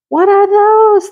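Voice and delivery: high thrilled what-is-this; 1 excited squeal. Real, not acted. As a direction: squeal at the new find. Excited discovery.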